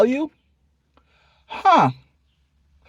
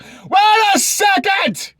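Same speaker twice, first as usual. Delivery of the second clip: shouted exclamation; 14 semitones higher, 3 dB louder, much slower than usual. Acted. Exaggerated outraged second take.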